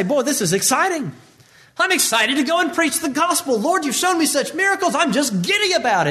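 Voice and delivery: high, bubbly mock-giddy voice; giddy excitement. Preacher mocking what Peter never said, giddy and high. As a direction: play the eager disciple, all excited. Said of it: "Simon doesn't say".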